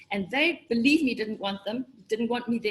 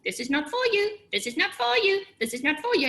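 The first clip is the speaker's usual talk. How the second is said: a lightly voiced, higher nagging voice repeating itself; nagging inner voice; 8 semitones higher than usual. The little voice inside telling her to quit, a light, higher nag. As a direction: needle yourself from the inside. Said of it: "a nagging little voice inside".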